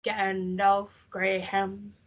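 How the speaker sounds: slow, menacing quote; slow mock menace; slow villain line. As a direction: drag it out like a sinister wizard. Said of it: "he was like".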